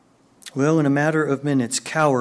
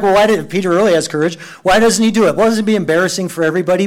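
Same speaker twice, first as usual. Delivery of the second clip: quick objection; 4 semitones higher, 5 dB louder, much faster than usual. Pretend. Imagined listener objecting.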